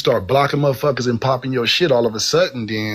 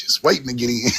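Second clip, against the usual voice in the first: ordinary delivery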